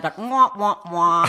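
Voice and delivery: loud sung wah wah wah, falling; mock sad trombone. Telling the host her dream flopped, loud mock fail fanfare. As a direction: play a joke losing fanfare.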